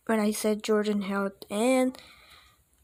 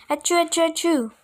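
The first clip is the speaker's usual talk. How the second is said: sneezing fit; 6 semitones higher, much faster than usual. A narrator's reading.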